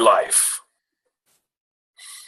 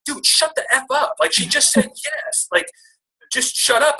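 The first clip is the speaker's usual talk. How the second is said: much higher, laughing, scolding himself; much higher, amused inner voice scolding himself; exasperated inner voice; 14 semitones higher than usual. Retold or imitated. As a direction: tell yourself off, half laughing. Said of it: "this little voice in my head".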